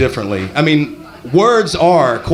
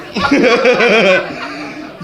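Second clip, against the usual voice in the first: higher-pitched burst of laughter; real laugh; 7 semitones higher than usual. Real, not acted. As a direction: crack up at the crowd. Comedian laughs again at audience.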